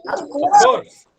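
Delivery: high, loud "of course!"; spring up in pitch on course; eager, emphatic agreement; excited of course. Shown as a demonstration. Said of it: "teacher models expressive phrase".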